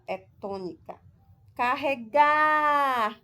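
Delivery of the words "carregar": carregar with a long, loud, raised GAR; stress on GAR. Shown as a demonstration. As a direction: call out and stretch the last syllable of carregar. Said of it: "o gá é a sílaba tônica". (carregar devagar, com o GAR forte e alongado)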